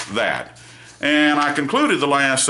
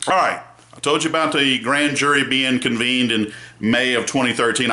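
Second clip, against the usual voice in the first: usual speaking voice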